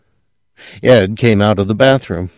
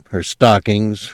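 ordinary delivery

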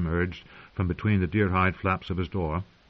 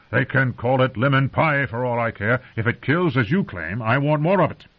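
snorting dismissal; 6 semitones higher, 5 dB louder than usual. A narrator's reading. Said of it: "Mormont snorted".

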